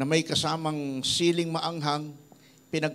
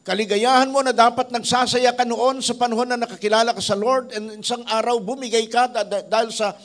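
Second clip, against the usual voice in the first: louder, higher sermon delivery building conviction; preaching; 4 semitones higher, 5 dB louder than usual. Acted. Pastor exhorting his congregation about temptation, fervent preaching. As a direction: drive the lesson home to the congregation.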